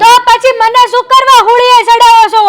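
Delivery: loud, exasperated retort; angry outburst. Acted. Wife snaps back at husband over his plan, angry outburst. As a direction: fire back loudly in a quarrel. Heated quarrel between couple.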